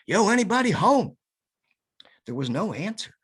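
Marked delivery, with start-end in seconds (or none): 0.1-1.2 calling into the house, loud and high; yelled out. Retold or imitated. Calling through a stranger's screen door, loud shout. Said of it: "and I yelled".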